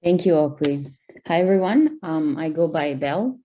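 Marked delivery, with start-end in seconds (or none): none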